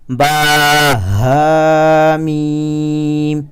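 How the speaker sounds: با ہا میم drawn out very slowly, thick; heavy recitation. Shown as a demonstration. Teacher reciting letters for students. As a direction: stretch با ہا میم heavily. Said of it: "اسے موٹا کر کے پڑھیں گے".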